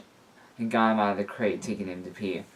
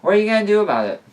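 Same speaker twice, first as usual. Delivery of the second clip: much higher, louder, fast teasing taunt; playful challenge; 12 semitones higher, 9 dB louder, much faster than usual. A narrator's reading. Liam cheekily daring his boyfriend, fast and high. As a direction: dare him, cheeky and quick.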